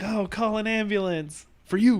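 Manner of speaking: meme voice. Retold or imitated. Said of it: "he's like".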